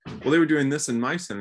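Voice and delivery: mock know-it-all. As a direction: play someone correcting the room. Quotes someone in mock exchange.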